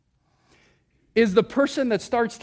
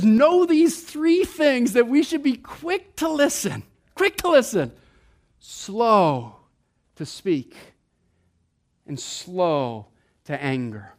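slow and quick drawn out with pauses, fading; preacher lingers on each phrase; slow emphatic reading; 2 semitones lower, slower than usual. Shown as a demonstration. Preacher spelling out the verse slowly. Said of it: "He says".

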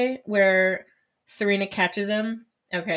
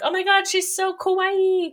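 gushing; 10 semitones higher than usual. Retold or imitated. Girls gush.

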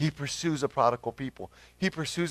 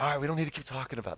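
hurried brush-off; 6 dB quieter, much faster than usual. Retold or imitated. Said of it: "He's like".

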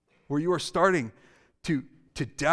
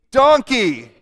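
"Donkey." much louder and higher; louder call-out; 6 semitones higher, 11 dB louder than usual. Shown as a demonstration. Pastor leads second chant, much louder. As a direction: bellow it with the whole room. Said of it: "We could do that again".

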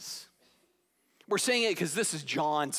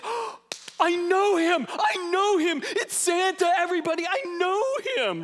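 Buddy the Elf excitement; 11 semitones higher, 6 dB louder than usual. Retold or imitated. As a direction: gush like a starstruck child meeting Santa. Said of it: "his expression is".

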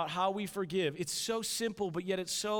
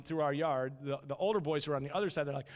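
ordinary talk, nothing marked

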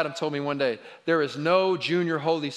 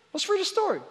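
put-on high-pitched, coaxing call to the kids; eager parent's invitation; faster than usual. Parent offering kids a story, eager and high-pitched.

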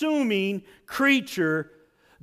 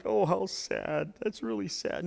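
mild mock pity; 7 dB quieter than usual. Shown as a demonstration. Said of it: "It's not like".

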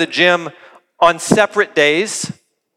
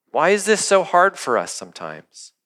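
ordinary delivery

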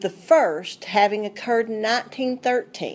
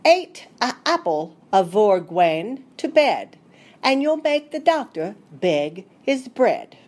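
put-on old Devon country accent, rhyme recited the Devonian way; Devonian dialect. [name] reciting Elizabeth Wright's old rhyme in a Devonian dialect. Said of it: "try my best to present it the way Elizabeth would have".

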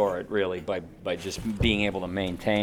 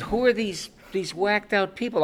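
put-on amazed kid; 9 semitones higher than usual. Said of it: "I said".

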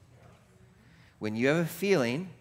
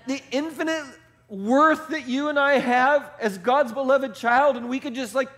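higher and louder, fired-up preacher; full-force preaching; 9 semitones higher, 5 dB louder than usual. Acted. Pastor rousing his congregation, full-force fired-up preaching. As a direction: preach it hard and rising to the back row.

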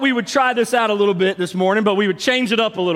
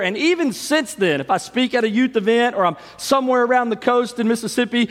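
ordinary talk

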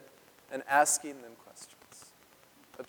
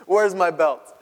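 mock threat; 2 semitones higher, 7 dB louder, slower than usual. Mother threatening spanking.

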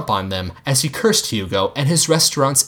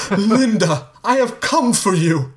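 higher, grand romantic call; 9 semitones higher than usual. Acted. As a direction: suitor announcing himself. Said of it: "Hugo called".

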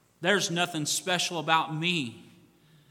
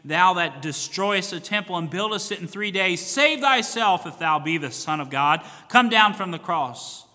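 loud, higher jeering taunt; loud scoffing crowd on the road; mocking crowd; 4 semitones higher, 9 dB louder than usual. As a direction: hurl it up at him in scorn. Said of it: "wagging their heads and saying".

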